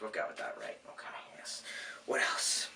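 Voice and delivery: whispered aside to himself; whispering. Real, not acted. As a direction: drop to a whisper.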